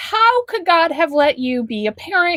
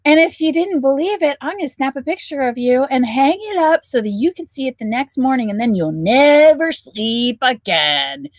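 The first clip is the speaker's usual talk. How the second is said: usual speaking voice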